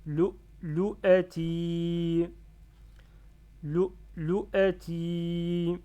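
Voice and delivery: LOU, LOU A TI loud, slow, long final i; whole-word reading. Shown as a demonstration. Teacher reads word without barriers for learners, slow and loud. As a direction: recite LOU, LOU A TI, holding the last syllable. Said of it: "la barrière et je lis".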